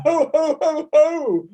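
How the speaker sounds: ho, ho climbing into a laugh; high ho laughs. Shown as a demonstration. Teacher leading the class, high loud ho, ho. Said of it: "Fourth time".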